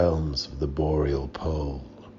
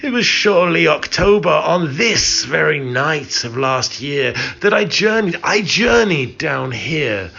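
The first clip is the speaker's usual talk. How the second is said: much higher and louder, rushed cry of dread; anguished cry; 9 semitones higher, 6 dB louder than usual. A narrator's reading. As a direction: cry it out as the memory hits. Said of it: "and I cried".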